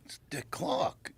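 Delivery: whispered in awe; awed whisper. Real, not acted. Comedian spotting Dick Clark backstage, awed whisper. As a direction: breathe the name in wonder. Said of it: "who, wow".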